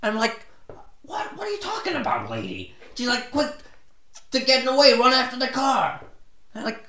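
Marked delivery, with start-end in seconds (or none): 1.0-2.7 high-pitched, disbelieving question; baffled, retold comeback; incredulous retort. 3.3-6.0 high, loud urging, imitating the lady; mimicked urgent yelling; retold shout. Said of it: "And she shouts".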